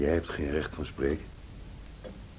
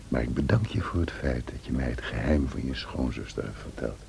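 ordinary talk

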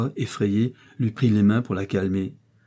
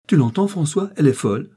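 much higher, louder furious cry; enraged cry; 6 semitones higher, 6 dB louder than usual. A narrator's reading. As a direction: shriek to her son that she's mad. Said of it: "s'écria la quenelle enragée".

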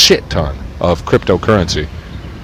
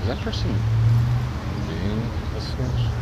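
whispered aside; 2 semitones higher, 11 dB quieter than usual. Real, not acted. Whispering while spying on someone. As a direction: say it under your breath.

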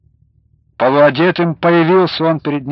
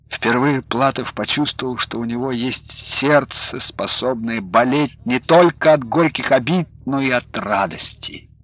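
plain reading voice

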